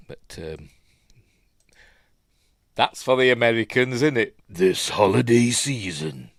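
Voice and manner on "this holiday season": slow, lightly mocking trailer announcer; mock American trailer voice. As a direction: send up the trailer's phrasing, slowly. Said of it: "We don't say that".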